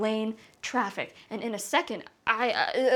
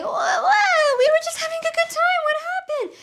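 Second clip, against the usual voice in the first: high, loud, rushed alarm; suddenly leaps up in pitch, alarmed; alarmed whoa; 4 dB louder than usual. Retold or imitated. Nanny seeing the sandwich stomped, high alarmed cry. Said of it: "I'm like".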